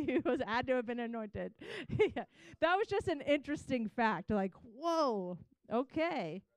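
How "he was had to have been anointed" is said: talking through laughter; laughing. Real, not acted. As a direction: joke while breaking into laughs. Joking about the couple's ages.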